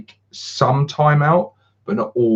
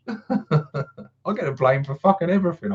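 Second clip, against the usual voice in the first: laughing; 7 semitones higher than usual. Real, not acted.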